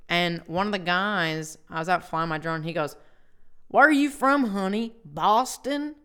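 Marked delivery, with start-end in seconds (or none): 3.7-6.0 higher, louder mock questioning; imitating a nosy stranger. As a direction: call it out like a curious boater.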